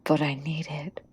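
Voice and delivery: soft pleading whisper. Acted. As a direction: whisper it, wanting something badly. Pleading quietly in character.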